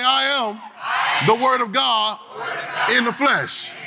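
high, slow shouted declaration; preacher calling the crowd to proclaim it; preached call. Shown as a demonstration. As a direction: call it out slowly to the back rows.

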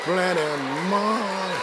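soft, slurred "Plenty money"; mock drunk. Shown as a demonstration. Preacher showing the crowd a drunk mumble, soft and slurred. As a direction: mumble "Plenty money" like you've had a few. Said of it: "Say it like you're drunk".

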